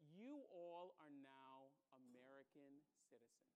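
judge. Retold or imitated. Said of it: "the judge said".

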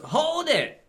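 high, snappy call; cartoon shout. Reading Wheeljack's entrance line, high snappy shout. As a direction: stop them in their tracks, cartoon style.

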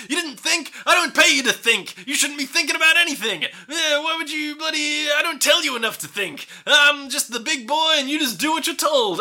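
high, barking tyrant boss; cliched movie boss. Retold or imitated. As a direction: chew him out like a cartoon boss. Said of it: "cliched fucking movie boss imaginable".

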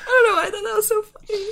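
high-pitched, laughing through the words; barely talking through a laughing fit; real helpless laughter. Real, not acted. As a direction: squeak it out while cracking up. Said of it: "[name] is laughing so hard at her own thing".

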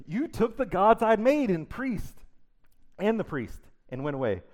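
wounded furious shout; outraged protest. Acted. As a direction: let Micah's hurt anger burst out. Micah's outraged protest acted.

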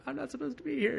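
lightly mock a meek reply; high squeaky mock voice. Retelling a comic book, squeaky mock reply to doctor. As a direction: pitch it way up, a cartoonish little squeak.

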